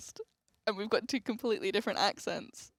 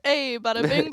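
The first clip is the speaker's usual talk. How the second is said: hammed-up New York Italian accent, a bit louder; mock New York Italian; 3 semitones higher, 4 dB louder, slower than usual. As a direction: play a cartoon New York mobster. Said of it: "That's as close to America".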